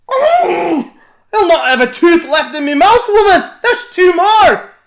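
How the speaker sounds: very loud, very high howling holler; hollering giant. A narrator's reading. Giant losing teeth to the pans, hollering. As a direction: howl in toothless pain. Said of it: "Cucullin hollered even louder".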